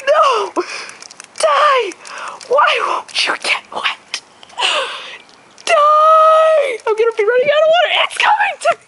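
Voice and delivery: high, loud panicked yells; frantic shouting. Real, not acted. Frantic spraying, bird approaching.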